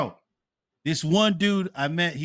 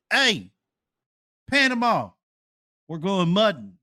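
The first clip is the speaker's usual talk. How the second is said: imitating the Texan friend; 6 semitones higher, 3 dB louder than usual. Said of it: "This guy said to me".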